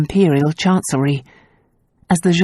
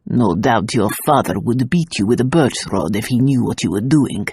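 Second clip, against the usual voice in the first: low, much deeper stern demand; gendarme demanding; 6 semitones lower than usual. A narrator's reading. Gendarme confronting the couple, low stern demand. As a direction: demand it like a suspicious policeman.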